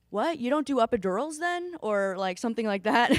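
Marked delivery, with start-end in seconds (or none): 0.1-1.8 incredulous question. Retold or imitated. Curious visitor asking the doula, in high disbelief. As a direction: voice jumps up in puzzled disbelief. Quoting a puzzled festival visitor.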